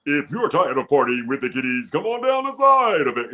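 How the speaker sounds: high-energy club promo read, pitch climbing; polished party ad. Shown as a demonstration. Voice actor inviting listeners to party, energetic ad read. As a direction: sell it with soaring, partying energy. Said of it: "that terrible copy? It went from, you know, it went to".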